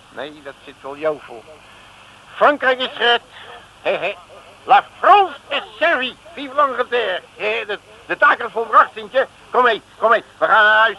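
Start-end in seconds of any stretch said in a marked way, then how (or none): 2.3-11.0 louder, higher victory cry; triumphant cheer. Acted. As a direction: crow with triumph. Triumphant after saving France.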